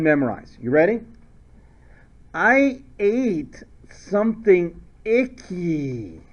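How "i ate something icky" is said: very slow, deliberate chant of a memory phrase; slow dictation of a silly phrase; drawn-out mnemonic. Shown as a demonstration. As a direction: stretch each word out, slowly, for the class.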